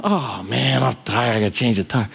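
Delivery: low, mildly hammed-up grumbling; mock upset. Preacher mimicking himself over a flat tire, mock upset. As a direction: play at complaining over a petty nuisance. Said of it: "I'm upset".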